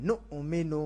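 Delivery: নু'মিনু with a clear glottal stop; correct Arabic recitation. Shown as a demonstration. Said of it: "নুমেনু বললে ভুল হবে।".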